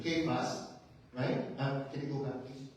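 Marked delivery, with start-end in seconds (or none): 0.0-0.7 Cephas said KAY-fas, with a hard K; proper pronunciation. Shown as a demonstration. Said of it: "That's a proper pronunciation".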